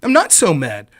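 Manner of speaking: usual speaking voice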